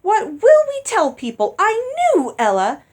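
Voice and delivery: loud, high, accusing; shrill reproach. Acted. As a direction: blame her mother, near screaming. Daughter scolds mother in panic.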